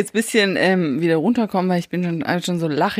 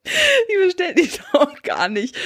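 laughing protest; 11 semitones higher, slower than usual. Real, not acted.

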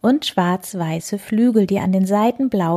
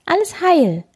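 high-pitched tiny bird voice; little chirp; 11 semitones higher, 4 dB louder than usual. A narrator's reading. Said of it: "piepst er". (hohes Piepsen)

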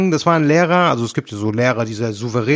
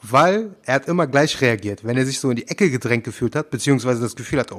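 ordinary delivery

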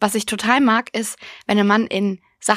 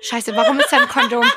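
much higher, laughing through the words; laughing panic; 12 semitones higher, 3 dB louder than usual. Retold or imitated. Caught by her mother with a condom on the floor, high and laughing. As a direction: squeak it out half laughing.

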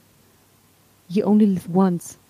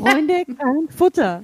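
very slow, every word weighted; slow emphatic slogan; 3 semitones higher, much slower than usual. Acted. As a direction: spell the slogan out slowly. Repeats slogan slowly.

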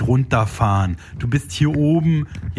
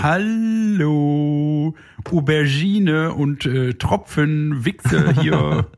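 high, slow put-on voice; playful emoji voice; 5 semitones higher, much slower than usual. Naming crude emojis to his co-host in a high put-on voice. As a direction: greet them like a cartoon character.

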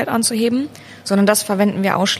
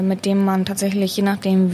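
ordinary talk, nothing marked

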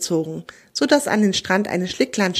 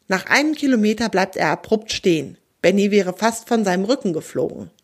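usual speaking voice

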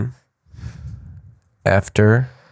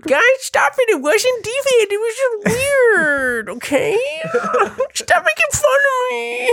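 much higher, whining and laughing; whiny high mock voice; slower than usual. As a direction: whine like a hurt kid.

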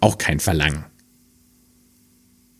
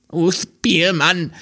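higher, slow little hare; 10 semitones higher, slower than usual. Acted. Hare calling to its new master, higher and slow. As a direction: sigh it up high as a humble hare.

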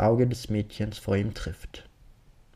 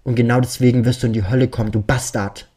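loud, fast furious outburst; screaming; 7 dB louder, faster than usual. Acted. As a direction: rage it out in terror. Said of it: "beginnt Tom zu schreien".

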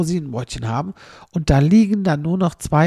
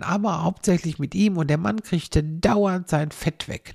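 usual speaking voice

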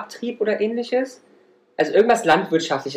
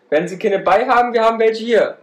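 market crier; 5 semitones higher, 4 dB louder than usual. Pretend. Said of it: "Und du bist der Marktschreier".

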